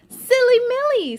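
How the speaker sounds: high, loud exclamation; exclaiming teacher. Acted. Teacher Miss Hershey reacting to the ants, exclaiming. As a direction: catch her attention with surprise.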